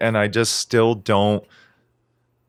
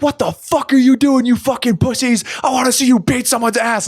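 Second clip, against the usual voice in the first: very high, loud aggressive stage yell; mock angry frontman; 4 dB louder than usual. Podcaster mocks a dickheadish singer yelling at fans, loud high yell. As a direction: berate the crowd to start a pit. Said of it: "dickheadish in quotes".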